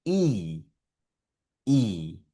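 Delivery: "I" through rounded U lips; I sound. Shown as a demonstration. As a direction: demo the inner I sound. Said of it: "va a salir el sonido".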